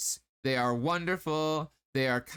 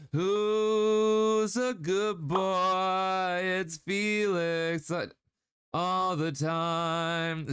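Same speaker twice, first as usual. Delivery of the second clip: slow, drawn-out doggy coo; pet baby talk; much slower than usual. Pretend. Cooing at Felix like a good dog, slow pet talk. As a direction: croon it like praising a puppy.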